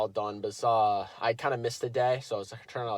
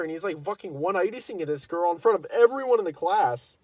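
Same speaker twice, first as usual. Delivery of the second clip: excited outrage; 7 semitones higher, 5 dB louder than usual. Real, not acted. Outraged retelling with laughter.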